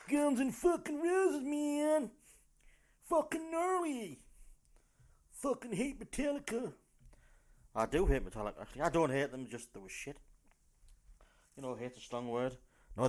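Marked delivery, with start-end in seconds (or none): 0.1-6.7 high-pitched, put-on fan voice; hammed-up rock fan. Comic mocking Guns N' Roses fans, high put-on voice. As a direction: squeal it like a die-hard rocker.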